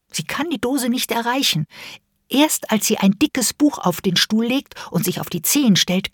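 ordinary delivery, nothing marked

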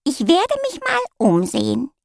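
high, grand cat announcement; announcing cat voice. A narrator's reading. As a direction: proclaim it like a haughty cat. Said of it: "verkündete die Katze".

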